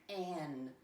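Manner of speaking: "can" broken into a hard k, then "an"; "can" with the k split off from the rest; sounded out. Shown as a demonstration. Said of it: "What do you hear".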